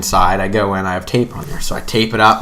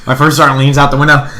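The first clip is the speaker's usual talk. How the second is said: normal talking voice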